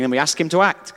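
normal talking voice

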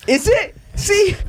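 high-pitched triumphant Is it, See; excited vindicated pitch jump; surprised gotcha. Real, not acted. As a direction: shoot up high to catch your friend out. Catching friend in same confusion.

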